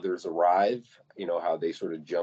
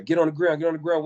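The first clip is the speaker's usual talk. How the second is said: retold police orders; 7 semitones higher, faster than usual. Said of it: "it's like they're saying it".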